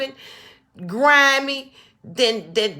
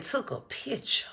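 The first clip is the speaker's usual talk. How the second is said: whisper; 2 semitones higher, 6 dB quieter than usual. Real, not acted. Describing the photo to viewers, horrified whisper. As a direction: barely breathe it out in disbelief.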